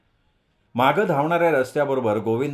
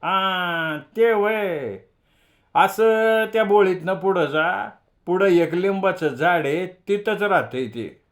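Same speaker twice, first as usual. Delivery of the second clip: put-on a higher, slow little boy showing off the way; proudly giving directions like a know-it-all kid; small boy boasting to the visitors; boastful boy; 6 semitones higher, slower than usual. A narrator's reading. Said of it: "मोठ्या फुशारकीनं म्हणालं".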